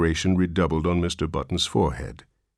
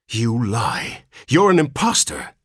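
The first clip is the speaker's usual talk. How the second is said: accusing; 5 semitones higher, 3 dB louder than usual. A narrator's reading. Father accuses old man.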